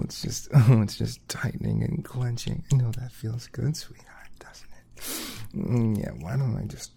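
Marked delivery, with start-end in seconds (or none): 2.7-5.7 soft breathy whisper, close to the ear. Acted. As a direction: hush it, intimate and teasing. Whispered teasing.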